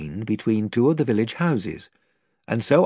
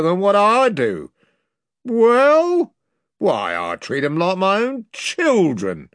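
louder, higher mock-offended dealer; indignant slaver; 5 semitones higher, 5 dB louder than usual. Pug protesting to the lord, mock-offended. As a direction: protest your kindness slyly.

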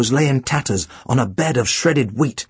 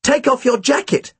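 yelling; 9 semitones higher than usual. A narrator's reading. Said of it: "Then I yelled at Tim".

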